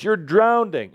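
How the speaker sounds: high, alarmed cry; acted panic, pitched well up; scream. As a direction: shout a sudden warning. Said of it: "It's gonna be that scream".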